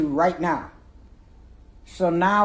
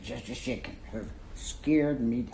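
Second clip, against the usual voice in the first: ordinary delivery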